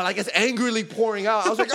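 ordinary talk, nothing marked

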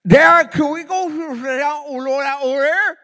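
slow, high and loud, mouth stuffed with gauze; teen daughter with gauze in her mouth, slurred and loud; mouth full of gauze. Retold or imitated. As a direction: mumble it through a mouthful of gauze after wisdom teeth. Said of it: "how did it sound like?".

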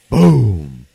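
loud, falling boom; imitated wall pounding. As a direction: boom it out like a fist hitting a wall.

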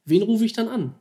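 buzzing with adrenaline; frantic excitement. Retold or imitated.

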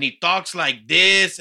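slow, loud, drawn-out mimicry; Conan impression. Podcaster mocking how the wrestler talks, slow loud impression. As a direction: imitate his talk, loud and dragged out. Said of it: "he sounds exactly the same ... talks like this".

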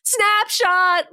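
high, loud battle cry; screamed. A narrator's reading. Ryan fires his power at Sabretooth, screamed. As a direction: scream it like an attack move. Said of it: "Ryan screamed".

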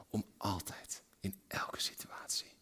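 hushed, intimate plea; whisper. Real, not acted. Preacher drops to whisper.

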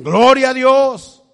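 loud, high, drawn-out "Gloria a Dios"; shouted praise. Acted. Believer shouting praise after losing his house. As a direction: cry "Gloria a Dios" out slowly at full voice. Voicing Christians praising God despite loss.

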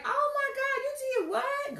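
high, loud, fake surprised; mock shocked praise; fake amazement. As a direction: gasp it like a phony friend.

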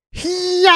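high mock karate shout "Hiya"; hammed-up kung fu yell; imitating a karate yell. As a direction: bark out a cartoon martial-arts cry. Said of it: "music that goes".

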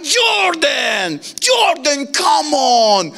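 high, drawn-out mock wail; exasperated dad shouting. Dad to son in the car home, exasperated cry. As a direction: wail the son's name in protest. Mocking dad's complaint in the car.

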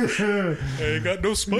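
cartoon bear voice. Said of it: "We should just do voices".

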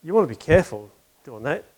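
lightly voiced proper minister telling Joe off. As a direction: gently scold your friend like a proper clergyman. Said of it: "very proper".

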